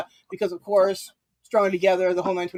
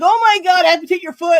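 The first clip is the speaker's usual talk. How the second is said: high, louder mock panic; mock alarm; 9 semitones higher, 8 dB louder, faster than usual. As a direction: play up her alarm. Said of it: "She's like".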